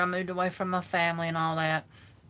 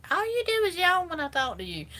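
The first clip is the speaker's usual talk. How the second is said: complaining friend; 12 semitones higher than usual. Retold or imitated. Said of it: "they're like".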